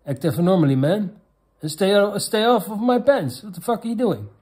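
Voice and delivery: staged indignant shout; mock outraged yell. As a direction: snap back at her, half-joking outrage. Acted angry retort in quote.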